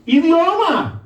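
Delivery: high, punched IDIOMA, falling at the end; emphatic exclamation. Real, not acted. Preacher driving home the key word, emphatic.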